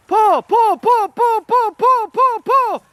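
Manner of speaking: high rhythmic calls of pull; hauling a rope. Real, not acted. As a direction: chant each pull with effort. Pulling the canoe, calling the strokes.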